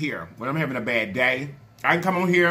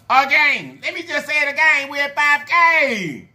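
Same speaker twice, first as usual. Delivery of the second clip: high, loud, excited announcement; excited celebration; 10 semitones higher, 5 dB louder than usual. Real, not acted. Vlogger repeats the five K milestone to viewers, excited celebration. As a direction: proclaim it high, stretching the last words out long.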